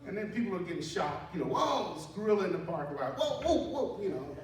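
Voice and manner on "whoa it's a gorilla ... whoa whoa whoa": mock surprise. Bystanders shocked by a gorilla.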